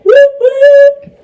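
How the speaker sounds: high, loud playful cheer; whooping with glee at the news; celebratory whoop. Real, not acted. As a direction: cheer it like a party call. Celebrating DVD release.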